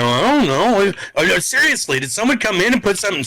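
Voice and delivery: high, fast fake-innocent denial; mock innocence. Buddy hides the ring box from his girlfriend, high fake-innocent hurry.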